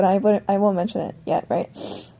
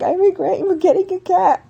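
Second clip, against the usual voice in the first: mock tearful, higher-pitched complaint; high, put-on crying voice; imitated crying; 8 semitones higher than usual. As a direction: imitate an upset brother wailing regret. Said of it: "he's, like, crying".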